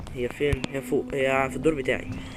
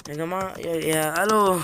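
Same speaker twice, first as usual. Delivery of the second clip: rattled, raised-pitch alarm; badly startled; 5 semitones higher, slower than usual. Real, not acted. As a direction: exclaim as if the scare just hit. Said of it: "أنا أول مرة أتخض كده".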